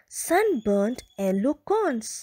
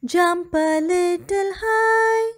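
slow coaxing call; 6 semitones higher, 5 dB louder, slower than usual. Acted. Urging the fox.

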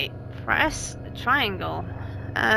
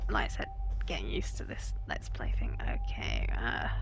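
hushed whispered aside; 10 dB quieter than usual. Real, not acted. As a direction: whisper it under your breath.

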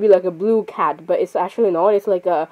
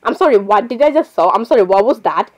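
ordinary talk, nothing marked

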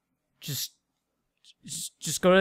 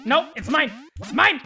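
possessive whining; 7 semitones higher than usual. Pretend. Possessive over weapon.

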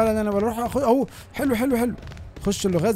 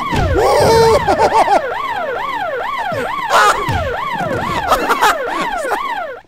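long shrieking laugh; 6 dB louder than usual. Real, not acted. Long shrieking laugh after chase.

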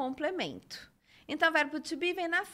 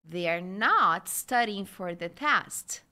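slow, lower sentence with a strong stretched "not"; negative intonation; 4 semitones lower, 4 dB louder, slower than usual. Shown as a demonstration. Teacher repeats the negative example, slowly stressing "not". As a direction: lean hard on "not" in "They are not studying". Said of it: "Vou falar mais uma vez".

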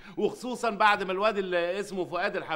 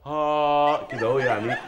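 slow, proud demo of his own voice; a slow, self-satisfied sample of his voice; showing off his voice; 2 semitones lower, 3 dB louder, slower than usual. Shown as a demonstration. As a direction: let the voice linger, showing it off. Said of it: "أنا صوتي من نوع الجراف".

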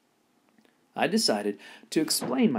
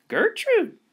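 much higher, loud squawking parrot cry; irritating parrot scream; 8 dB louder than usual. Acted. Parrot in the pet store screaming its owner's name. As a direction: screech the name like a bird. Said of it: "the parrot screamed again".

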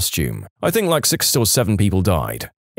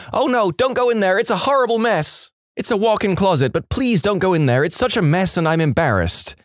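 mock yell; 8 semitones higher than usual. Homeowner begging the agent to keep out of her closet, high-pitched mock yell. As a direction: act out a pleading yell in a raised pitch. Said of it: "she yells".

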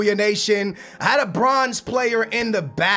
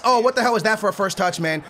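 normal talking voice

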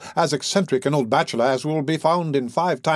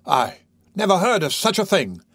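high, astonished exclamation; exclaimed; 6 semitones higher than usual. Acted. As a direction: burst in shocked and fussing. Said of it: "exclaimed the fat gentleman".

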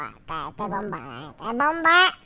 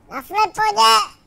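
"sleponě" called out higher, louder and slower; louder emphatic repeat; 7 semitones higher, 5 dB louder, slower than usual. Real, not acted. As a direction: shout the word sleponě, drawn out. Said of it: "ale na sleponě". (hlasitě a pomalu zvolané „sleponě“)

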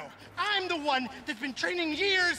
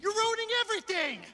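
heated protest; 7 semitones higher than usual. Acted. Kenny and captive argue.

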